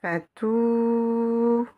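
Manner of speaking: Fatou with the final "ou" held long; sustained, level "ou" ending Fatou; long vowel. Shown as a demonstration. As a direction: stretch out the "ou" of Fatou. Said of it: "avec la voyelle longue".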